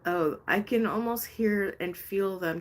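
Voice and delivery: ordinary talk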